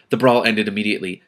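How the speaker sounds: plain reading voice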